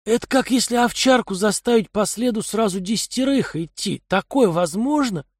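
a streetwise thug scoffing; gruff thug voice. Acted. Kai's voice in dialogue.

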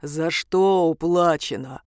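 low, slow, deep demon voice; deep, drawn-out creature's query; booming bass. A narrator's reading. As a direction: rumble the question out slowly in a bass. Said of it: "кто-то пробасил".

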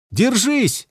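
high, urgent shout into the wind; shouting over wind. Acted. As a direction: yell it over roaring wind. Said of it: "крикнул он, перекрикивая ветер".

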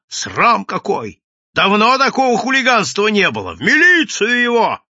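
high, loud outrage building up; indignant crowd. A narrator's reading. Indignant crowd demanding the police take the madman, outraged shouting. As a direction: call out in scandalised protest. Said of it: "Толпа негодовала".

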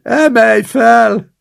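high, slow, loud senile plea; babbling. Acted. Old man stammers at his grandson to lift him, high and loud.

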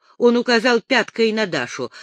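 plain reading voice

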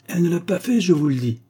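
high, forceful assertion; emphatic insistence. Acted. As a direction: insist so it brooks no doubt. Agar insists on Rosa's innocence.

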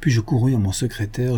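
ordinary delivery

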